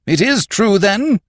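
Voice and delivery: high, loud startled question; alarmed disbelief. A narrator's reading. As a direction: pounce on it, voice jumping up. Alarmed boss presses for confirmation.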